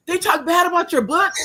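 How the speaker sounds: put-on a teen who can't believe it; shocked granddaughter asking back; astonished disbelief.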